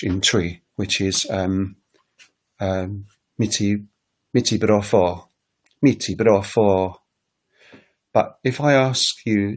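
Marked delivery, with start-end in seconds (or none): 3.4-7.0 "Miti brofo" with the pitch falling at the end, said slowly; Twi statement. Shown as a demonstration. Teacher demonstrates a Twi statement with a falling end. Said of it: "I'm gonna give you an example".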